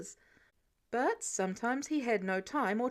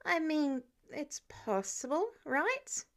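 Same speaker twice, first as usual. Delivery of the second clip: higher, hesitant and rising on "right"; tentative plea; 5 semitones higher, slower than usual. Acted. Peter pushing an unlikely excuse on Tony, tentative. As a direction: float it weakly, in pieces.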